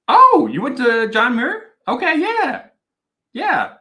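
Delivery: high, loud, pleased surprise; light up at a shared connection; excited recognition. Real, not acted. Surprised recognition of viewer's school.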